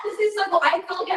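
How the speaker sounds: gushing; excited. Real, not acted. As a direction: burst out with delight. Thrilled reaction to the story.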